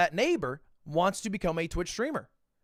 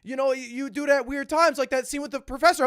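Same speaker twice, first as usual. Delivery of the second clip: high, rapid imitation of a woman; flustered reassurance; 7 semitones higher, faster than usual. Actress reassuring him about his laugh, high and hurried. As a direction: rush the reassurance in a raised pitch.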